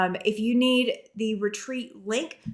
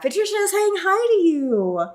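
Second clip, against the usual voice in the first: put-on baby talk to dog; 10 semitones higher, 5 dB louder than usual. Talking to the dog.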